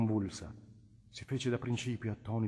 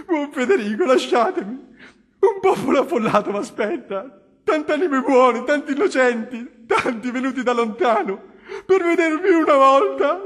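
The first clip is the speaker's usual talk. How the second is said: much higher, louder tearful pleading, voice breaking; sobbing plea; 15 dB louder, slower than usual. Acted. The weeping Innominato begs the cardinal to leave him, sobbing. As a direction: beg through sobs to be let go. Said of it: "disse singhiozzando".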